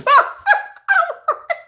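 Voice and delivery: loud, high squeal of laughter; high laughing burst. Real, not acted. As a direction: let out a shrill laugh. Laughs at friend.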